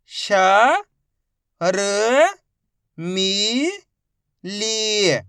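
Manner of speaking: श, र, मी, ली each held and separate; drawn-out letter drill on श, र, मी, ली; sounding out letters. Shown as a demonstration. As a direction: spell out श, र, मी, ली with pauses. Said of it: "एक एक अक्षर का उच्चारण करके".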